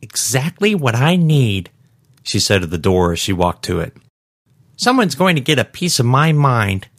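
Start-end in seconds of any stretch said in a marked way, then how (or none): 0.0-1.7 high, slow, haughty satisfaction; indignant shopper. Acted. Shopper addressing the office door, haughty. 4.8-6.9 high-pitched, huffy threat; indignant shopper. Acted. Shopper heading in to complain to staff, indignant.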